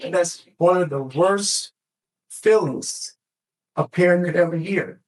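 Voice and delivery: usual speaking voice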